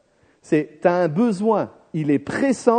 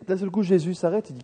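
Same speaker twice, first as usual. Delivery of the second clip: usual speaking voice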